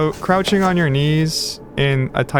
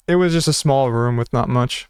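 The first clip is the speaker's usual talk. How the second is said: ordinary delivery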